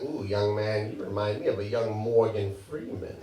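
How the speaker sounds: lightly voiced older woman cooing at a young man; low, hushed admirer.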